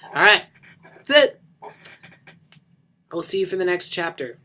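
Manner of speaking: cheery sign-off. Real, not acted. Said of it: "a lot of fun to read".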